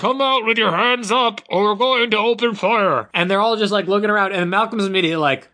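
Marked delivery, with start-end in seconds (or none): 0.0-3.1 through a megaphone. Retold or imitated. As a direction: call it out like a cop on a loudspeaker. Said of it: "this is how it sounds, guys".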